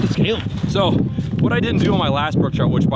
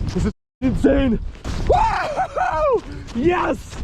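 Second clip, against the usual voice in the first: ecstatic yelling; 3 semitones higher, 4 dB louder, much slower than usual. Real, not acted. Celebrating the catch alone.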